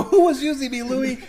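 high, loud mock panic; freaked out. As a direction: demand it in hammed-up alarm. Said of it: "here's my second freak out".

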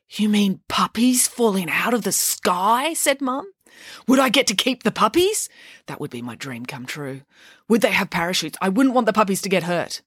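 picture puppies raining down with delight; a mum imagining her dream come true; excited dreamy mum. Acted. Mum delighted by puppy idea.

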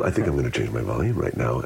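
low, quick aside; self-conscious inner thought. Acted. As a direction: drop down and rush it like a thought. Acts out an over-focused speaker's thought.

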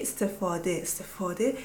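استفاده softly as es-te-faw-deh, long back vowel; Farsi pronunciation. Shown as a demonstration. Correcting back to Farsi, soft native es-te-faw-deh. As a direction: give استفاده its rounded middle 'aw'. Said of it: "But we get".

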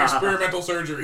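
loud, cracking up while quoting; laughing punchline. Acted. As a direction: play the doctor through laughter. Doctor's line in joke, cracking up.

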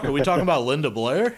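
fast, loud, laughing through it; laughing question. Real, not acted. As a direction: blurt it out while cracking up. Laughing while asking about Linda Blair.

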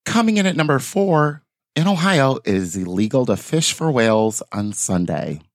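high announcer voice dropping to normal; hammed-up high countdown call, easing down.

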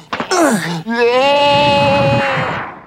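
high, crying pterodactyl screech; pterodactyl shriek. Acted. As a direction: let out a dying pterodactyl cry. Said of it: "I'm gonna do that one more time".

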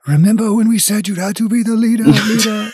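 echoing ethereal energy being; high, fading ghostly ancestor chant; spirit voice. Acted. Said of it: "spirit voice fade outs".

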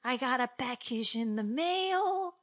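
high, drawn-out mock delight; hammed-up maternal surprise; imitating mom. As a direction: play a thrilled mom, stretching it slowly. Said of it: "then she's like".

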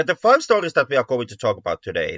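ordinary delivery

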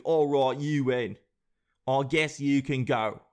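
put-on loose British accent veering Australian; mock British. England letting the US go, grudging mock-British accent. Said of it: "British accent and not go Australian".

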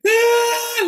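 high mock scream held back in volume; acted-out skydiver's shriek; mad-person scream. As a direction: imitate a terrified scream, way up high. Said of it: "screaming like a mad person".

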